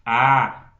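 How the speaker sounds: sustained open "Ah"; open ah vowel. Shown as a demonstration. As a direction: hold a long open "Ah". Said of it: "eu posso falar". ("Ah" aberto e prolongado)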